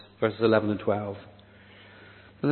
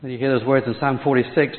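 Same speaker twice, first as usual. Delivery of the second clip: usual speaking voice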